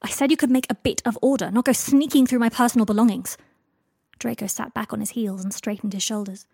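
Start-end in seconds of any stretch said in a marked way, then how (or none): 0.0-3.4 louder, higher angry scolding. A narrator's reading. Harry scolding Draco for going through his belongings, angry. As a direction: tell him off angrily. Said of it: "Harry was angry".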